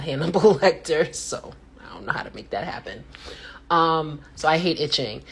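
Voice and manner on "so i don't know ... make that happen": breathy whispered joke aside. Real, not acted. Whispering a joke aside to viewers. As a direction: drop to a sly whisper.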